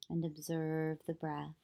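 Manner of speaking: calm, even meditation voice with long pauses; unhurried yoga nidra guidance, leaving long silences; guided meditation. Acted. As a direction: guide them gently into stillness, phrase by phrase.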